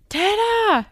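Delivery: high, loud imitation of dad yelling through the house; mock full-lunged call. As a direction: yell the name like a dad upstairs. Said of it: "he'd yell at the top of his lungs".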